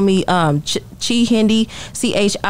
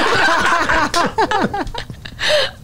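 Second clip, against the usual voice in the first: high laugh; 10 semitones higher than usual. Real, not acted. Laughing at herself.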